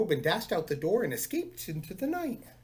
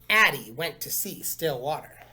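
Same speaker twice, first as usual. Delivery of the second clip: plain reading voice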